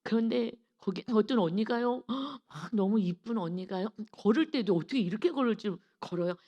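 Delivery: normal talking voice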